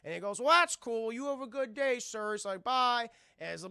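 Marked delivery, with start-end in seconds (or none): 0.4-2.3 high, bright-eyed janitor greeting; lightly voiced cheerful janitor; chipper janitor. 2.6-3.1 high, loud friendly bye; cheerful janitor farewell; chipper bye. Retold or imitated. Janitor says goodbye.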